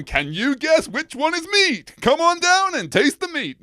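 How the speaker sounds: high, loud, slow showman hype; game show host. Pretend. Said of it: "I'd love to be something like".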